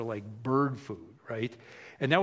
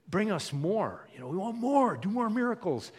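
higher-pitched mock clamoring; eager, raised-pitch demand; eager demanding crowd; 6 semitones higher than usual. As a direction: imitate a crowd pleading for more. Crowd demanding more from Jesus.

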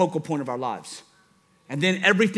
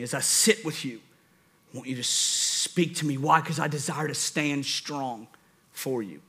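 earnest prayer; 4 semitones lower than usual. Shown as a demonstration. Prays to the Holy Spirit.

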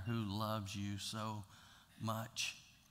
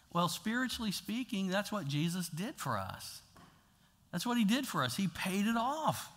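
ordinary delivery

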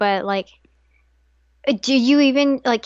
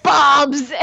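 loud; 4 semitones higher, 6 dB louder than usual. Retold or imitated. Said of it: "I was like".

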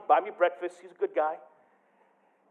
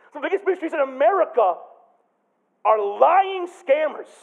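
higher, louder pulpit voice; preaching at full force; 8 semitones higher, 7 dB louder than usual. Acted. A pastor preaching to his church, with full force. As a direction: drive the point home to the congregation.

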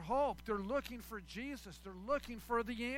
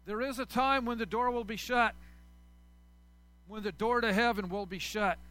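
louder, urgent sermon voice; preaching at full force; 3 semitones higher, 7 dB louder than usual. Acted. Pastor urging sinners that the door of heaven is still open, in a loud pleading voice.

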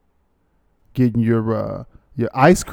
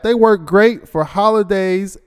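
higher and louder, slowed for show; emphatic reread; 8 semitones higher, 4 dB louder than usual. Shown as a demonstration. Host rereading the ad line with playful emphasis. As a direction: reread it with gusto, slowly. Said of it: "Look at that".

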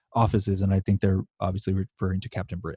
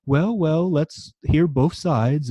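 mock posh British lady, slow; plummy British drawl, hammed-up a little; 5 semitones higher, much slower than usual. Co-host retells Betsy being posh, mock British accent. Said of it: "she's being real fucking posh".

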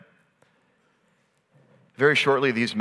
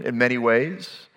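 ordinary delivery

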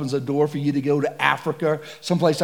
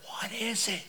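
hushed nervous question; 5 semitones higher, 14 dB quieter than usual. Retold or imitated. Nervous question in tall grass.

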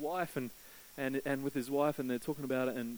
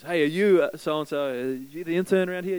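stranger calling out; 3 semitones higher, 7 dB louder than usual. Retold or imitated. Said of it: "and says".

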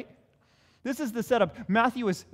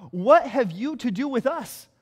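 crying out; 4 semitones higher, 6 dB louder than usual. Acted. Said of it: "they cried out".

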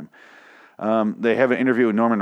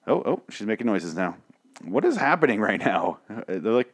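usual speaking voice

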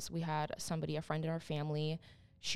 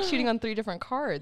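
normal talking voice

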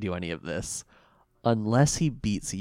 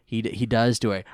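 normal talking voice

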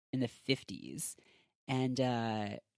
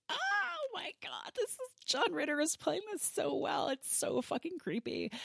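very high, softened squeal; mock scream; 4 dB quieter than usual. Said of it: "I was, like, screaming".

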